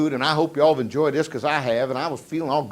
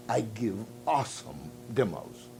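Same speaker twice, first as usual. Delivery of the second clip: slow, softer, drawn-out quote; slow deliberate quote; 4 semitones lower, 10 dB quieter, slower than usual. Retold or imitated. Salesman answering what his greatest asset is, slow and deliberate. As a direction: imitate him, unhurried and low.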